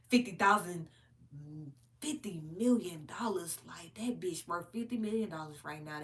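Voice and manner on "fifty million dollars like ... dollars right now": hushed, breathy disbelief; stage whisper of shock. Real, not acted. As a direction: whisper it like a secret amount. Whispered aside about money.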